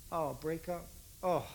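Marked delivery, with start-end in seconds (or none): none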